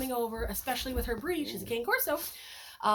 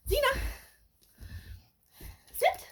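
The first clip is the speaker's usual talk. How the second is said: high cue; 12 semitones higher than usual. Real, not acted. Cue to puppy.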